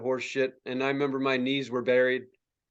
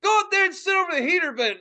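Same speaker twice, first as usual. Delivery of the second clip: high, urgent imitation of dad; dad ordering; 13 semitones higher, 4 dB louder than usual. Dad sending son to the heater, ordering. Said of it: "he's like".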